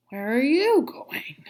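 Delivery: breathy, slow, half-hearted wolf growl; mild growl. Acted. Wolf questioning the girl in the forest, mild mock growl. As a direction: lean in like the sly wolf asking. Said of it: "growled the wolf".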